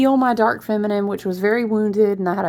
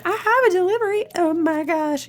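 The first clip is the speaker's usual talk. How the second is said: high, quick surprised squeal; 9 semitones higher than usual. Retold or imitated. Remembering finding the unexpected pre-order, squealing high. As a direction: caught off guard by a package. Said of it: "And then I was like".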